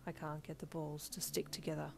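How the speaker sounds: soft, subdued admission; half-confused, half-sad. A narrator's reading. Todoroki admitting trouble to Midoriya, quietly sad and confused. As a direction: say it quietly, a little lost. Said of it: "half-confused, half-sad voice".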